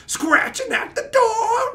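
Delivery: very high, slow, wailing cry; unhinged Nicolas Cage wail; hammed-up frantic Cage scream; Nicolas Cage impression. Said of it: "like Nicolas Cage".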